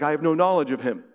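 hasty denial. Retold or imitated. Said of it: "He's like".

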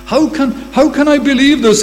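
Abraham answers God's promise, high and incredulous; Abraham doubting. Acted. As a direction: cry out in disbelief. Preacher voices doubting Abraham.

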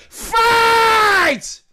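high, loud, stretched cry of Fight; climactic cry. Real, not acted. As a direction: throw it out like a battle cry.

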